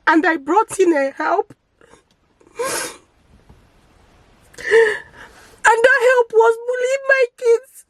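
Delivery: crying out the words in a high, broken voice. Real, not acted. Mother telling viewers about her bullied kids, sobbing. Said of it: "I'm so sorry that I'm crying".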